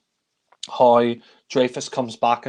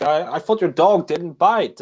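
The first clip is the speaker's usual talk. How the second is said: slow, higher mock protest; pitched-up, put-out imitation; indignant complaint; 5 semitones higher, 4 dB louder than usual. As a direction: retell a bitten man's drawn-out complaint. Retelling bitten man's complaint to owner.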